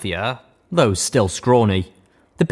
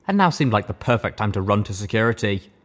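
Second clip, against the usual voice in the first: usual speaking voice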